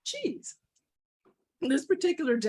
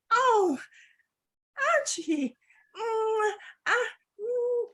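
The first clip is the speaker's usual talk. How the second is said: high-pitched helpless fretting; distressed dog; 11 semitones higher, 3 dB louder, slower than usual. Acted. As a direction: whimper in dismay over the loss. Dog frets as cheese is taken.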